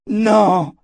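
loud, high drawn cry; shouted refusal. A narrator's reading. Richard refuses to kill Callan, crying out. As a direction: shout it out in protest. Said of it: "cria Richard".

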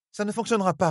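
put-on a firm young noblewoman cutting him off; Elaine's voice. A narrator's reading. Elaine tells Rand it won't work, firm. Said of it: "lâcha Elaine".

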